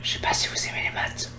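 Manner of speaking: whispering softly into the mic; hushed ASMR whisper. Pretend. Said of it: "vidéo A S M R".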